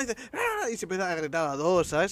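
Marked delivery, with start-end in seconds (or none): 0.3-0.7 mock shriek. Woman in video shrieks.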